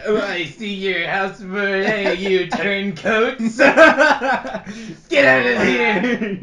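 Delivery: slow, loud, laughing Scottish brogue; bad Scottish accent. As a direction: drag out a hammy Scottish insult. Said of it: "a French person trying to do a Scottish accent".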